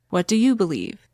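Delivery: normal talking voice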